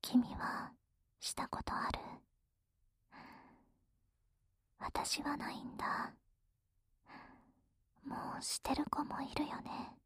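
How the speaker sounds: soft intimate whisper. Acted. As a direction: breathe it close and quiet. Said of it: "ささやいてあげる".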